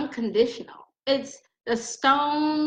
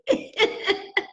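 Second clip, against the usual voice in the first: laugh; 10 semitones higher than usual. Real, not acted. Laughs at own joke.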